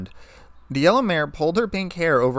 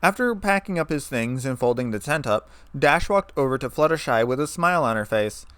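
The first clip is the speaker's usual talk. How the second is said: plain reading voice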